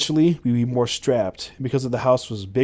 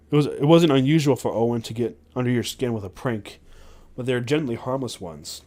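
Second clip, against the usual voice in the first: ordinary delivery, nothing marked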